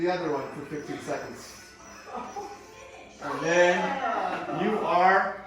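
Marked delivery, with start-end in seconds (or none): 3.2-5.4 drawn-out reveal. Real, not acted. Teasing drawn-out finish.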